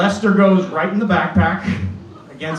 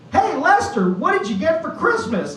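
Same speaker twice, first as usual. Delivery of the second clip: put-on high, chirpy kid act; bright kid setting up a joke, higher; kid ventriloquist; 10 semitones higher than usual. Boy asks his doll on stage, high and chirpy. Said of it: "I was like".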